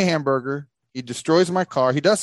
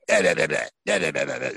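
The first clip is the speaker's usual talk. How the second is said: babbled nonsense mimicking an angry rant; mock Dan; 7 semitones lower than usual. Storyteller showing what his angry friend sounded like, mocking gibberish. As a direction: rattle out nonsense syllables as a mocking imitation. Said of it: "this is what he sounded like".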